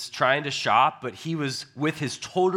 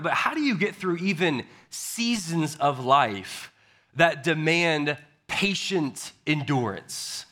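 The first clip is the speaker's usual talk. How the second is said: ordinary talk, nothing marked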